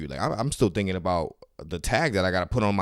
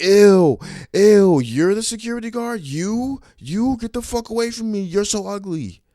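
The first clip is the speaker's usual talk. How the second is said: high, loud, snooty shriek; disgusted woman voice; 13 semitones higher, 9 dB louder than usual. Retold or imitated. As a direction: mimic a disgusted stuck-up woman. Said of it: "That's what she said".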